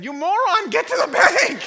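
high, very loud mock fury; hammed-up scolding bellow; exasperated shout. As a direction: holler the urgent order. Pastor orders usher again.

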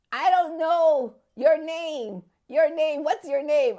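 high, loud, insistent friend; urgent retold asking. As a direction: act out the friend's urgent plea. Crying friend urgently asks her name.

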